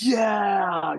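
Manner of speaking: high, loud victory yell; triumphant shout. Acted. Lifter celebrates a personal best, triumphant shout. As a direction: cheer a big lift.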